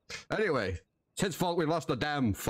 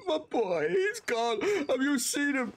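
put-on high, pleading cries for his boy; distraught father; 14 semitones higher than usual. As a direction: beg strangers for help, voice pitched way up. Asks stranger about missing boy.

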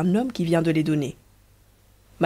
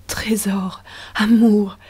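murmured endearments; 3 semitones higher, slower than usual. Acted. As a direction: breathe each pet name against her lips. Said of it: "qui lui murmurait sur les lèvres".

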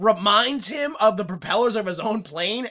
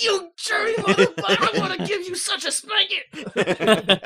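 mock outraged general; 12 semitones higher than usual. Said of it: "the general's like".

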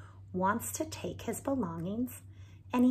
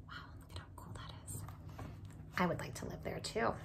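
whisper; 9 dB quieter, much faster than usual. Shown as a demonstration. Whispered aside to listeners.

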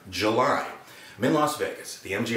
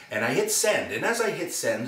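ordinary talk, nothing marked

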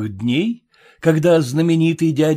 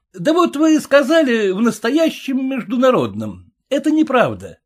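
heckler voice; 12 semitones higher than usual. A narrator's reading. Heckler arguing with announcer.

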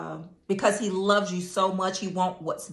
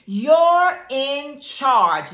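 emphatic declaration; 6 semitones higher, 5 dB louder, much slower than usual. Real, not acted. Preacher exhorting the congregation.

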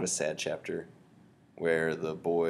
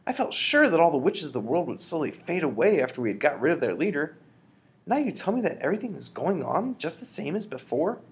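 put-on higher, louder indignant boy voice; higher, louder upset pleading; crying out; 8 semitones higher, 4 dB louder than usual. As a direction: keep protesting to grandmother. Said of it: "I cried".

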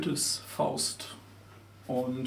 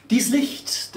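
raised refrain; 9 semitones higher, 4 dB louder than usual. Acted. Repeated poetic refrain.

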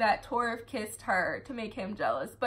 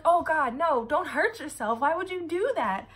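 higher, louder, a hint of a frantic boyfriend; panicked Galen; 5 semitones higher, 4 dB louder than usual. Galen begging Emma to stop, high mock panic. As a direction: plead with her not to get hurt, pitched up. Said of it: "and he's like".

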